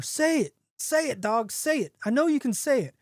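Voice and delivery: high-pitched mock shout, little extra volume. A narrator's reading. Man to the silent dog, impatient shouting. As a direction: insist in a restrained, narrated shout. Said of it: "starts shouting louder and louder".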